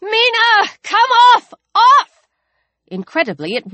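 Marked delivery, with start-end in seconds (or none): 0.0-2.1 high, desperate dog command; frantic calling off; desperate shout. A narrator's reading. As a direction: yell desperately, building louder. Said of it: "and then desperately".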